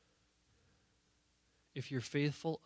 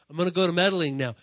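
louder, faster punchline; 1 loud and quick; 4 semitones higher, 13 dB louder, faster than usual. Shown as a demonstration. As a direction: belt it out, no accent. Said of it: "I'd say it with a little accent".

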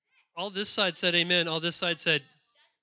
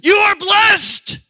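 slow, much higher, much louder proclamation; prophetic shout; 13 semitones higher, 16 dB louder, much slower than usual. Acted. Preacher acting Balaam, a slow booming shout of blessing. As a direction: bellow the blessing out over the valley. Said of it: "He goes".

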